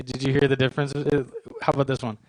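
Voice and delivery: usual speaking voice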